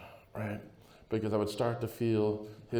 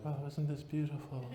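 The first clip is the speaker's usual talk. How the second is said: put-on softer, higher, wide-eyed little boy; 4 semitones higher, 9 dB quieter than usual. Little boy marvels to his friends, softly higher. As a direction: gush over it like a small child. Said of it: "you're a little boy and you're like".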